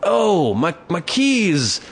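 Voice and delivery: high, slow, wailing fake dismay; hammed-up dazed drunk. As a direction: drag it out like a drunk faking panic. Drunk guy fakes lost keys.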